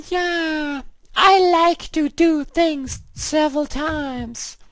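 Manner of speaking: put-on a falsetto girl persona ranting in English at the game. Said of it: "I'm playing the English girl right now".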